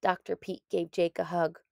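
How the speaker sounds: ordinary delivery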